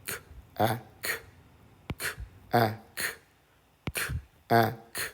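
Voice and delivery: K, a, k as three isolated sounds with gaps; phonics-style k... a... k, repeated; sounding out. Shown as a demonstration. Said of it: "Let's read this part".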